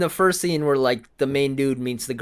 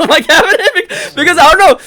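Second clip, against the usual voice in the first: laughing; 15 dB louder, faster than usual. Real, not acted. Laughing at own story.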